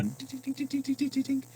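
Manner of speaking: high, light tink tink of a bad bearing; mimicked tinking noise. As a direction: tap out the metallic tink like a worn wheel bearing. Imitating wheel bearing noise.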